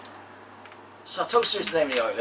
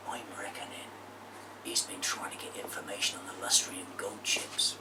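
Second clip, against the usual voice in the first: whisper; 5 semitones lower, 12 dB quieter than usual. Acted. Secret whispering.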